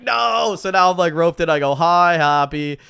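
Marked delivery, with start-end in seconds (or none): 0.0-0.6 high, loud protesting "no"; hammed-up cry of dread; mock dismay. Protesting as friend ropes him in, mock dismay. Retold protest at being roped in. 1.7-2.8 awkward greeting. Retold or imitated. Retold awkward greeting, roped in.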